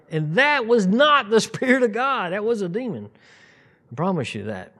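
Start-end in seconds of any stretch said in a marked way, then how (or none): 0.1-3.1 high, loud with a laugh; laughing emphatic statement; emphatic declaration. Real, not acted.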